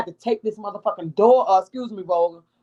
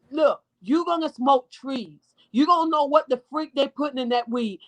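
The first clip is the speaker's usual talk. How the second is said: ordinary talk, nothing marked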